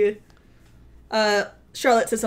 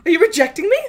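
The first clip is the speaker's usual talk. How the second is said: very high, louder incredulous questions; mock wounded disbelief; 12 semitones higher, 4 dB louder than usual. Kylo rejected after his confession, mock incredulity. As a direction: squeak out play-acted offense, drawing out the second question. Acting Kylo's reaction to rejection.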